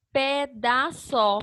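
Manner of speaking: slow, evenly separated syllables; spaced-out teacher's syllable reading. Shown as a demonstration. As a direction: sound out PÉ, DÁ, SÓ one by one. Trying another syllable order aloud.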